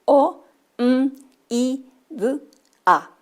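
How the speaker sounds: o, m, i, d, a each said alone as a separate sound; isolated sounds. Shown as a demonstration. Teacher isolating each sound of comida for children. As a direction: sound out a, i, d one by one. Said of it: "estamos frente a los sonidos".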